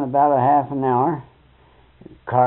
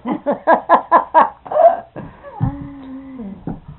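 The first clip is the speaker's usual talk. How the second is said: laughing; 12 semitones higher than usual. Real, not acted. Man teasing about a blushing listener, laughing. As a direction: laugh hard at your own joke. Amused by his own joke.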